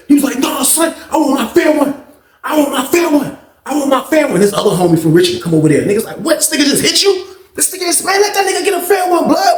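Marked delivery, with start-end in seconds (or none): 0.4-4.4 high, insistent voice; mock demand. Hit man demanding fight. 6.2-9.6 high shouted outrage; mock outrage.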